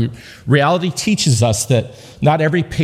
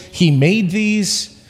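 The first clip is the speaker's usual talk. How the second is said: slow joking reveal; 5 semitones higher, much slower than usual. Real, not acted.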